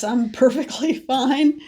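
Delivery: congested, laughing through it; allergy-stuffed voice. Shown as a demonstration. Showing viewers her allergy voice, stuffed up. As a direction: play someone blocked up by allergens. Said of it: "you might sound a little like this".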